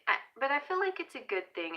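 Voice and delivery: usual speaking voice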